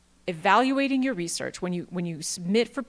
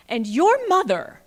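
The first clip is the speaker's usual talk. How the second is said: and your MOTHER, higher, louder and slowed; stress on mother; 8 semitones higher, 7 dB louder, slower than usual. Real, not acted. Presenter listing who requires data citation, punching mother. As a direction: land "mother" as a big comic punch.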